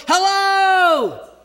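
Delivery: high, loud call into the woods; a father calling for his lost children in the woods, shouting; desperate shout. Retold or imitated. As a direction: yell into the dark forest for lost kids. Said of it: "I would stop and go".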